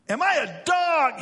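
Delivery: high, loud giant's roar, drawn out slowly. Acted. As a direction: roar the challenge, stretching the words. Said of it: "he roared at David".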